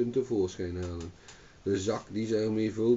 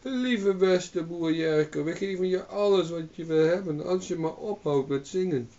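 pleading; 7 semitones higher than usual. A narrator's reading. Old hag begging the farmer to stop singing. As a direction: beg him sweetly to stop.

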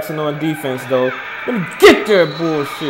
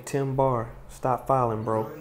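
ordinary talk, nothing marked

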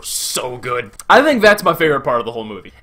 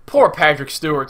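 ordinary talk

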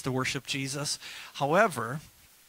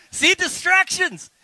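much higher and louder, drawn out slowly; joking exclaim; 11 dB louder, slower than usual. Real, not acted. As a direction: play up the stray slide for a laugh. Joking about leftover slide.

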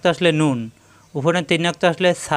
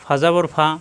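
ordinary talk

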